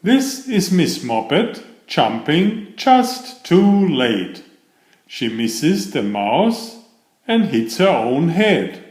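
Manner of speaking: usual speaking voice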